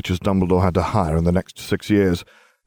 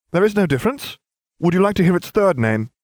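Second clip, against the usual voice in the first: a much higher, cheeky schoolboy voice; smug boy; 11 semitones higher, 3 dB louder than usual. A narrator's reading. Harry taunting Snape in class, high and cheeky. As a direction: rub your cleverness in his face.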